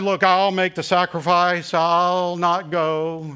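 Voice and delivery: loud, high, drawn-out martyr act; mock heroic sacrifice. Pastor retelling his offer to stay behind, in hammed-up martyrdom. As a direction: ham up the noble sacrifice, slowing right down on the last words. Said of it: "so I said".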